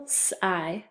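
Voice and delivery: "s-I" as a held s, then long i; first syllable sounded out. Shown as a demonstration. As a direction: draw out "s-I" sound by sound. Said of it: "First syllable".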